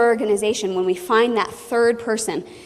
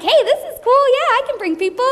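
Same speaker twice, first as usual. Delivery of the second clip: very high, bubbly delight; high gushing excitement; a very high, bubbly new teammate; excited recruit; 13 semitones higher, 4 dB louder, faster than usual. Retold or imitated. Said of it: "she's like".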